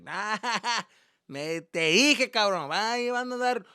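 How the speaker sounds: high, loud gloating dad imitation; mocking dad. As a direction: crow in Spanish at a caught son. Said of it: "He's just like".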